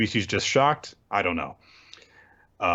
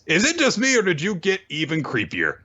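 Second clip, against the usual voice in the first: high mock Denji voice; 8 semitones higher than usual. Denji quipping at the villain.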